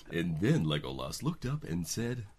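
ordinary talk

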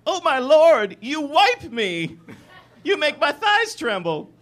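high, loud swooning woman; mock-breathless romance damsel; female lover role. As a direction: gasp it out as a hammed-up heroine, drawn out. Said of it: "She says".

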